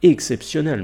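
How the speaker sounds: Exceptionnel said loud and high with a falling end; emphatic exclamation. Real, not acted. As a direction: punch out Exceptionnel in awe. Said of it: "repeated for emphasis".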